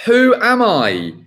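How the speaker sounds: normal talking voice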